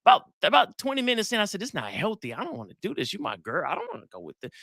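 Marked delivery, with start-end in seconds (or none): 1.6-4.5 quiet, gentle words to end it; soft calm plea. Retold or imitated. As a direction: softly back out of the fight. Calm quote to angry girlfriend.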